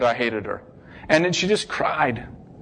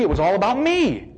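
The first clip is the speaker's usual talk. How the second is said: ordinary talk, nothing marked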